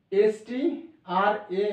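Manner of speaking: very slow stretched letters; spelling slowly for the student; drawn-out spelling. Shown as a demonstration. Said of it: "teacher spells the letters".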